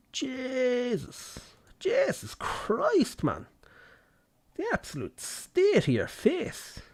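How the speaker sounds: high, slow, squeaky disgust at a face; mock shriek. Reacting to an ugly face on screen, high mock disgust. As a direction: squeal out horrified at the ugly leader.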